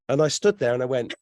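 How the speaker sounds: normal talking voice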